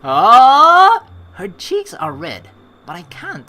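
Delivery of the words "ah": loud rising "ah" swooping high; loud drawn-out squeal. Real, not acted. Player reacting to the girl's blushing scene, loud squeal. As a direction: let out a long, high, excited cry.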